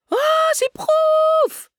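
put-on very high, drawn-out little girl's squeal; loud, slow, piercing child's cry of joy; joyful yell. A narrator's reading. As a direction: shriek with delight like a seven-year-old. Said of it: "hurla-t-elle".

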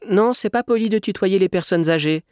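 put-on high-pitched reproving little boy; prim, high child reply; child's retort. A narrator's reading. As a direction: correct the grown-up in a high child voice. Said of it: "répliqua l'enfant".

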